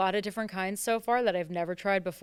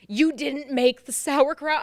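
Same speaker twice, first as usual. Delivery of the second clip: scolding mother; 6 semitones higher, 7 dB louder, slower than usual. Retold or imitated. Mother scolding her, slow, louder.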